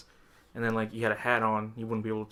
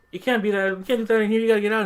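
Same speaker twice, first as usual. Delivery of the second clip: high, louder imitated telling-off; boss ordering out; 10 semitones higher, 5 dB louder, faster than usual. Boss throwing out the lady with the rat, mock scolding. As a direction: act out an exasperated order to leave. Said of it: "my boss was like".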